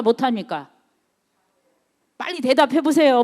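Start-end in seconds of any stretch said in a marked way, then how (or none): none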